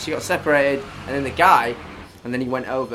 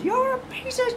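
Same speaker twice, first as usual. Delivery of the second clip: shrill, much higher mock scream; retold screaming woman. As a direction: shriek it like the furious woman at the fight. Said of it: "this woman comes up and goes".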